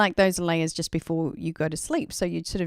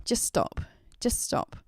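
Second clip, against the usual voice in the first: whispered, firm and calm; inner voice whisper; 4 semitones higher, 3 dB quieter than usual. Retold or imitated. Intuition telling her panicking mind to stop, whispered. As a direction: hush yourself with a quiet command. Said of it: "my intuition just kinda kicked in and said".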